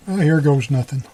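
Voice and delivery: ordinary talk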